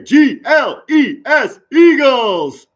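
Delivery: loud, high cheer for the team; fan shout. Real, not acted. Fan hyping his team with a loud cheer. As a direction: belt out the team name like a superfan.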